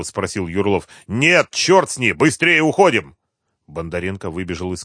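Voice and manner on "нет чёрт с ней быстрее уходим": loud, high impatient order; urgent command. A narrator's reading. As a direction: drop it and hurry them out. Gang leader urges flight.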